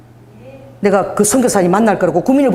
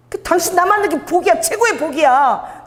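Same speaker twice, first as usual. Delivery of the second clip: high, drawn-out declaration of blessing; confident proclamation; 9 semitones higher, slower than usual. Acted. Evangelist telling a stranger he is blessed, high and slow. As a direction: proclaim it slowly, full of certainty. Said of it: "진짜 그 확신을 갖고 막".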